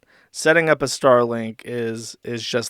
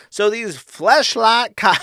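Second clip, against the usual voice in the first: mock rant; 8 semitones higher, 6 dB louder, slower than usual. Reading Ralph's tweet.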